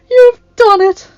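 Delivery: very high, loud, drawn-out exclamation; triumphant old woman crying out; joyful cry. A narrator's reading. Freed old enchantress thanks her rescuer, crying out. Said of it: "a voice cried out".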